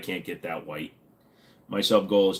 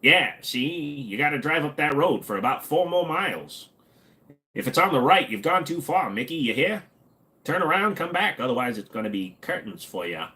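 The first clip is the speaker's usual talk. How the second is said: old-movie mobster impression, pitched up; higher, swooping old-time gangster voice; nineteen-thirties gangster; 4 semitones higher than usual. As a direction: talk like a thirties gangster giving orders. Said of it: "talking like a nineteen thirties gangster".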